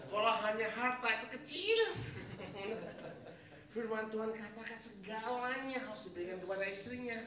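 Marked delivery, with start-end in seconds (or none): 1.8-3.7 airy unvoiced laugh; breathy laugh. Real, not acted. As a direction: let a breathy laugh slip out. Laugh after joke.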